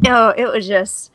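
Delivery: normal talking voice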